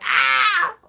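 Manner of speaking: shrill high yelp, short and loud; high-pitched girly scream. Shown as a demonstration. Showing the viewers how he screams when scared, a high loud shriek. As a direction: let out a sudden high girl's scream. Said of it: "I fucking scream like a fucking girl".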